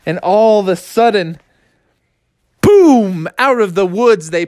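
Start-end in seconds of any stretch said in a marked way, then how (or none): none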